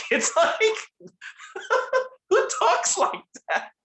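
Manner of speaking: normal talking voice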